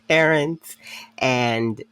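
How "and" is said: normal talking voice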